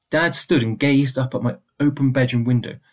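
usual speaking voice

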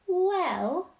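high, drawn-out "well"; hesitant, rather slowly. A narrator's reading. Lucy tries to be truthful yet kind, hesitantly. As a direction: stall while choosing kind words. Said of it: "rather slowly".

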